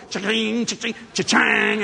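stretched ringing sound effect; slot machine noise. Retold or imitated. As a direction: imitate jangling casino machines.

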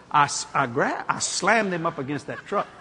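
normal talking voice

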